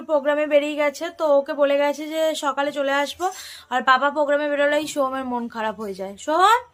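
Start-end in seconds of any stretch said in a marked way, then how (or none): 6.2-6.6 calling out. Real, not acted. Mother to her child Soham, calling out. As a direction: call his name on a rising questioning note. Calls the child to ask him.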